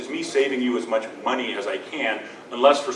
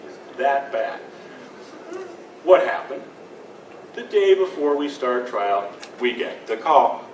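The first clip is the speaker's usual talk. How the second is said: ordinary talk, nothing marked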